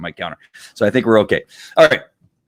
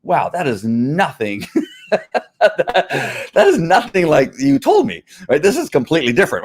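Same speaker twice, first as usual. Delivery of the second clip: laughing disbelief; 7 semitones higher than usual. Retold or imitated. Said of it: "you go".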